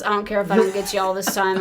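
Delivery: usual speaking voice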